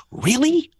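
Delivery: high-pitched pretend wonder; wide-eyed mock disbelief, way up high; mock amazement. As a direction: squeak it out like an enthralled youngster. Mock awe at war stories.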